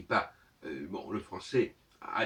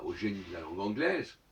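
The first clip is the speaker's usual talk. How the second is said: ordinary talk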